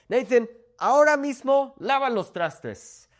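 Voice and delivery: high, loud scolding mom; a mother yelling at her son to wash the dishes now. Retold or imitated. Mom calling out an order to her son, high and loud. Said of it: "my mom is yelling at me".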